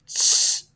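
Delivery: a single breathy "T" puff; letter T sound. Shown as a demonstration. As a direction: puff out "T" once. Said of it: "T for".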